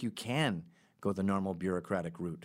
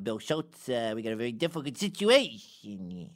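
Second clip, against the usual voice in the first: Tony Soprano. Acted. Mob boss breaking bad news to his associate, hammy impression. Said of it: "My Tony Soprano wasn't very good".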